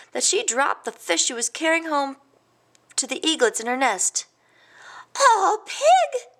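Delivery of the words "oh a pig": high, loud startled squawk; astonished cry. A narrator's reading. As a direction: cry out at an unbelievable sight. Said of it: "she cried".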